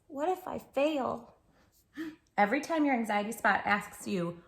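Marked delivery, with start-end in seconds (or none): 0.1-1.3 slow, a touch of worry; lightly voiced nervous girl; worried little voice. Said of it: "she says".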